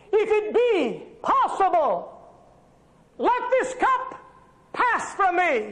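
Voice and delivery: high, pleading pulpit delivery; full-force preaching. Acted. Preacher voicing Jesus praying to the Father, full-force preaching. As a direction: plead it the way Jesus prays in the garden.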